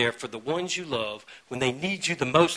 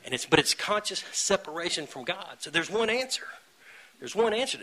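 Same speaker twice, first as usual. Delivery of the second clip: usual speaking voice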